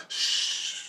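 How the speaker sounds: soft voiceless "shh"; meek shush. Retold or imitated. Him echoing the orb's hush, soft shush. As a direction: let a quiet hush fade out. He shushes along, imitating orb.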